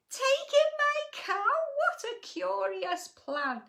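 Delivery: put-on high, loud, puzzled old lady; can't believe it, shocked; astonished old lady. A narrator's reading. Old lady shocked at being told to take in the cow, high and loud.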